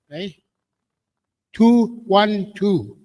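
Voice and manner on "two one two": calling out a number. Real, not acted. Calling ticket numbers at draw.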